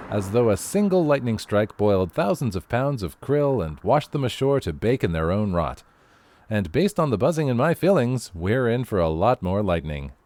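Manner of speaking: usual speaking voice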